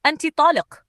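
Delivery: high, forceful verdict; harsh pronouncement. A narrator's reading. Husband divorcing wife before servants, harshly. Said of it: "قال كلمته القاسية".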